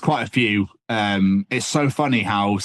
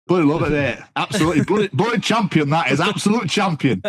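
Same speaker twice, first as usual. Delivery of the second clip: put-on Yorkshire accent; 7 semitones higher than usual. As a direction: ham up a cheery Yorkshire bloke in a Yorkshire accent. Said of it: "[name] loves a Yorkshire accent".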